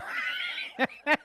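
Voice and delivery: squeaky laugh far up in the voice; can't hold back the laughter; high giggle. Real, not acted. Laughing at his own joke.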